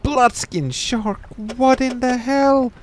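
high, slow, stretched-out cry; shocked disbelief. Real, not acted. Player reading the shark's name, high shocked cry.

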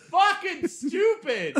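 high, slow laughing words; real laughing disbelief. Real, not acted.

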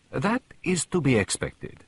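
dignified, deliberate Poirot; grave Poirot. A narrator's reading. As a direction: pronounce it slowly and solemnly. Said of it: "said Poirot gravely".

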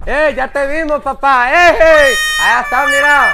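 high-pitched call across the street. Acted. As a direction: shout to catch him before he slips away. Calling out to runaway debtor.

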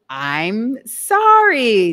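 slow, exaggerated quoted apology; drawn-out mock apology. Coach telling listeners to drop the apology, drawn-out mock sorry. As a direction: stretch each word out very slowly.

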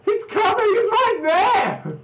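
high frantic warning; panicked yelling. Real, not acted. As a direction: shout the warning, half laughing. Monster in front of partner.

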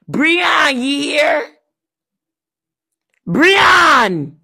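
loud, high call. Acted. Calling Breon to come listen. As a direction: yell his name across the house.

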